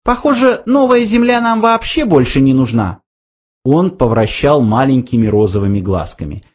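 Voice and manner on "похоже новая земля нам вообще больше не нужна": very high little mouse voice; matter-of-fact mouse. A narrator's reading. Mouse says the new Earth is unneeded, high voice. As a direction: pitch it up like a small creature.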